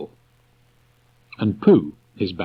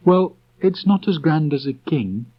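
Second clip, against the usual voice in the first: put-on higher boyish voice, gently correcting; Christopher Robin's voice; 9 semitones higher, 3 dB louder than usual. A narrator's reading. Christopher Robin reassuring Pooh, boyish high voice. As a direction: speak up high as the young boy.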